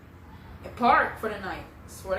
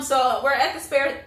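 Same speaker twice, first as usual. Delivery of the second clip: ordinary talk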